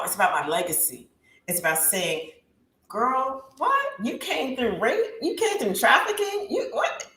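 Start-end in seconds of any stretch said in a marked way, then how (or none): 2.9-7.0 incredulous girlfriend reacting to shocking news; stunned disbelief. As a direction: pitch climbing higher with each shocked question. Imagined stunned reaction to survivor.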